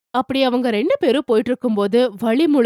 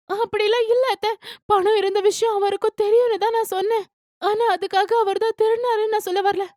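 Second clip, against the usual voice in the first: higher voice, quickly denying; defensive young wife; 9 semitones higher than usual. A narrator's reading. Daughter-in-law denying she called husband a thief, higher defensive voice. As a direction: protest gently, pitched up.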